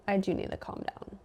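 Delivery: muttered sarcasm. Retold or imitated. Sarcastic reply to boyfriend.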